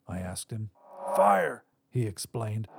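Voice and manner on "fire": higher-pitched raspy voice. A narrator's reading. Visitor wakes his past self, raspy. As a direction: rasp it out as an older double of yourself. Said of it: "though a bit raspy".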